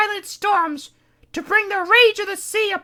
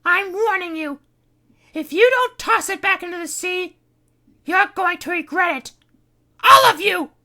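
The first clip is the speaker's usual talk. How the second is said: menacing warning; 14 dB louder than usual. Acted. Kitty threatens the crew.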